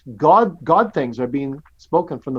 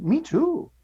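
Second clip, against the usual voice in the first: little sister; 11 semitones higher, 4 dB quieter than usual. Acted. Sister agrees.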